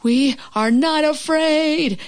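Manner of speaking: high, slow, stretched-out denial; mock scream. A narrator's reading. Frog and Toad hiding from the hawk, mock scream. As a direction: draw out a mild scream, pitched high. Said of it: "screamed Frog and Toad".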